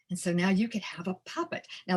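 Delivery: usual speaking voice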